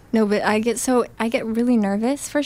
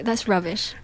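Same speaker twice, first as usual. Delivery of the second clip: put-on British accent. Joking in the praised British accent.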